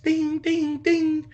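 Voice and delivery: high mock ding-ding; text alert dings. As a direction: chime it like a phone notification.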